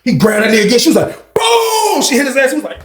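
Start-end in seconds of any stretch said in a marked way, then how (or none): 1.3-2.0 very high sharp boom; mimicked shot boom; gunshot boom. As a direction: shout the shot out. Imitating gunshot.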